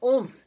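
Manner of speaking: loud, high impact grunt; a sharp oomph as he lands. Acted. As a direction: pig landing with a thump. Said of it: "he grunted".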